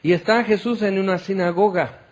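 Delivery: usual speaking voice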